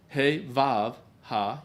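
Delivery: slow, spelled-out Hey Vav Ha; name each letter of Hey Vav Ha slowly; Hebrew letter names. Shown as a demonstration. Teacher reading Hebrew letters to class, slow and clear. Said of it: "that long is a Vav".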